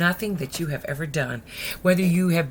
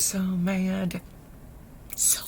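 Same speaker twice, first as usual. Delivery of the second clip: slow, ominous understatement; drawn-out emphasis; 5 dB quieter, slower than usual. A narrator's reading. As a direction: draw it out, then drop softer. Narrator dwells on sister's anger.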